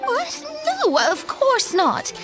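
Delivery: startled high yelp into a hasty denial; high-pitched, indignant cover-up; flustered denial. Acted. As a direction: protest it, caught off guard. Denies being short of money, flustered.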